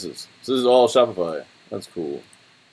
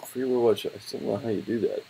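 ordinary talk, nothing marked

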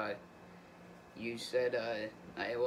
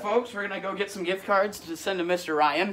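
higher and louder excited aside to the audience; 4 semitones higher, 5 dB louder than usual. Real, not acted. Caller tells viewers the next step, excited and louder. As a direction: hype up the viewers.

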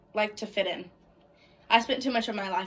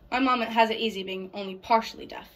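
ordinary talk, nothing marked

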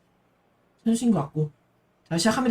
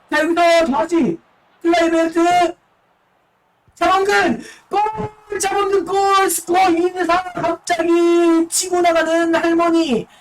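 esports caster; 8 semitones higher, 4 dB louder, slower than usual. Acted. Goal call in live commentary.

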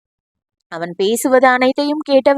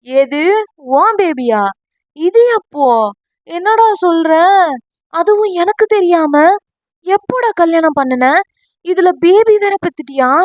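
high, loud shocked questioning; 6 semitones higher, 3 dB louder than usual. Acted. Friend shocked to hear Veeri has a baby, high and loud. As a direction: fire off questions in disbelief. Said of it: "என்று அதிர்ச்சியாக கேட்டான்".

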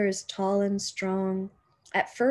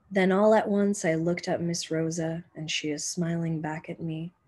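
usual speaking voice

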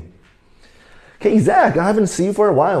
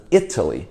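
"Italy" stressed first, then getting softer; strong start, fading away; 8 semitones lower, 4 dB quieter than usual. Shown as a demonstration. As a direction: hit the I of "Italy", let the rest fade. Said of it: "慢慢的越来越小声".

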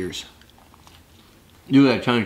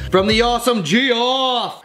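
grand announcer; 12 semitones higher, 3 dB louder, slower than usual. Pretend.

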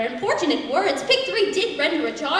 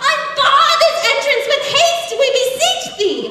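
high, loud theatrical plea; urgent calling out; 10 semitones higher, 6 dB louder than usual. Acted. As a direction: beg frantically to be let in. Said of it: "with urgent hoofs they pounded, calling out".

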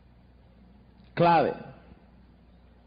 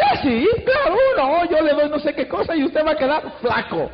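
very high, breezy, sure reply; confident saleswoman; 6 semitones higher than usual. Acted. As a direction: promise it without a doubt. Lorena answers with confidence.

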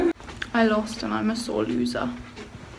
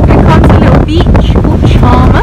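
loud and high in the wind; shouting over wind; 5 semitones higher, 16 dB louder than usual. Real, not acted. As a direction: raise your voice over gusts. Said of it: "I don't know if you can hear us".

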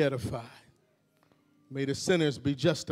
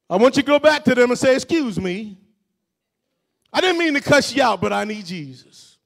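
higher, louder pulpit exhortation; preaching; 11 semitones higher, 9 dB louder than usual. Real, not acted. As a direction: urge each listener to say it out. Preaching at full force.

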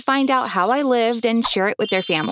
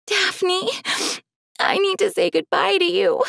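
tearful; 9 semitones higher than usual. Acted. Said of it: "she was sobbing".

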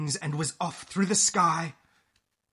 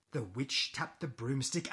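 usual speaking voice

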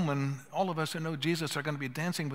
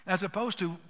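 normal talking voice

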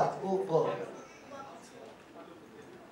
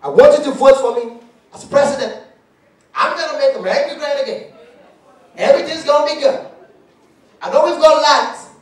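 high, loud mock campaign speech; hammed-up rally speech, much higher and louder; Obama impression; 7 semitones higher, 9 dB louder than usual. Comic imitating Obama campaigning, loud mock speech. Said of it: "We say".

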